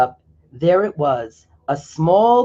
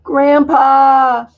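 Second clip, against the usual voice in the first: put-on high, loud child's call to grandpa; 9 semitones higher, 7 dB louder than usual. Felix calls out loudly to his grandpa. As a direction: call out like an excited little boy.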